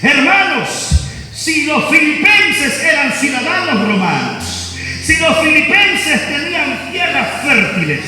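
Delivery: voz de predicador, más aguda y enérgica; predicando con fuerza. Acted. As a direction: proclama a la congregación con fervor. Pastor preaching about Pablo. (predicación apasionada)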